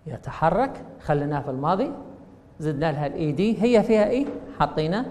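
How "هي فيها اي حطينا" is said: ordinary talk, nothing marked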